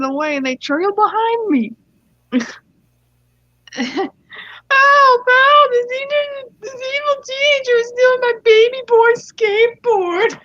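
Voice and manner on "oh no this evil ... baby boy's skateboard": very high, loud hammed-up wail; entitled mom. Entitled mom publicly accuses teenager of stealing, high loud wail. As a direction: cry out in mock alarm. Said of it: "here comes the voice actors".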